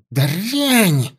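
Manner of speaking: выплёвывает оскорбление, выше; в ярости бросает слово; злой крик. Acted. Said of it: "закричала ситха". (ситха кричит на предательницу)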